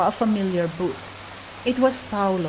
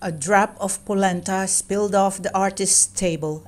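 usual speaking voice

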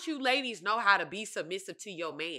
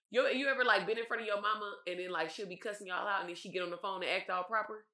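usual speaking voice

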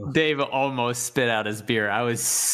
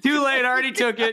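mimicking; 13 semitones higher, faster than usual. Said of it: "he's like".

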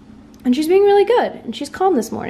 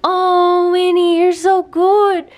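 high puppy-talk voice; 6 semitones higher, 4 dB louder, slower than usual. Real, not acted. Owner cooing praise at her new puppy, high baby talk. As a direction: coo up high at the dog.

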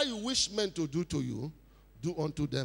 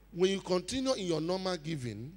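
ordinary talk, nothing marked

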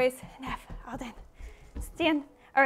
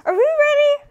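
high, loud hype question; rally the group; rousing call; 8 semitones higher, 8 dB louder than usual. Real, not acted. Teacher rouses children before the next race, calling out.

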